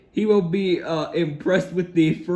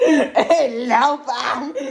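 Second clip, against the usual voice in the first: very slow, higher hammed-up bellow with a laugh; shouting at top of voice; 7 semitones higher, 3 dB louder, much slower than usual. A narrator's reading. Mister Noisy bellowing at the baker, hammed up. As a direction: stretch each word into a big mock bellow. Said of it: "shouting at the very top of his voice".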